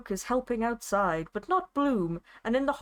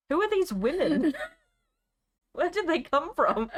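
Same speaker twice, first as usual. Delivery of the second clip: laughing questions; 6 semitones higher than usual. Real, not acted. Laughing at the story's nonsense.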